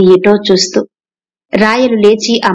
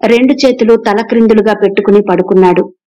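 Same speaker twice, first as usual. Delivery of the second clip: plain reading voice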